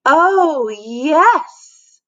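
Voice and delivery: high, lilting oh yes; girl trilling eager agreement; trilled. Acted. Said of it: "trilled Victoria".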